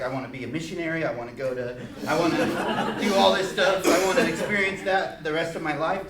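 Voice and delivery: fast, eager retelling breaking into laughter; tumbling out plans with a laugh; excited. Retold or imitated. Boy's excited plans retold.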